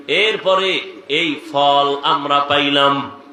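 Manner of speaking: high rhythmic litany easing down to a measured close; fervent sermon cadence; impassioned preaching. Acted. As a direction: pile up each item with rising force, then settle.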